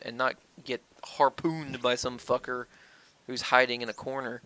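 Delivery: ordinary delivery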